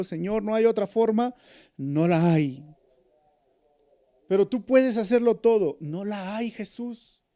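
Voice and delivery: desperate loud prayer in the garden; anguished plea. Retold or imitated. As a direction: beg with everything you have. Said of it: "Él dijo".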